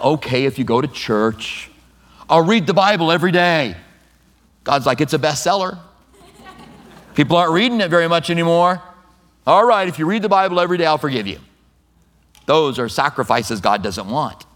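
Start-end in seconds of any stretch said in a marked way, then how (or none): 0.0-11.4 higher, faster, hammed-up casual God; mock God voice. Preacher imagining God haggling over Bible reading, hammed-up. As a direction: play a breezy God striking a deal. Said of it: "God's not like".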